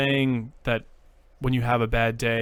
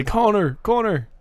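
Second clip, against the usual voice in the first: higher, louder mock Irish call; Irish accent; 10 semitones higher, 5 dB louder than usual. Shown as a demonstration. Correcting co-host with an Irish name, mock Irish accent. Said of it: "Tony's not an Irish name".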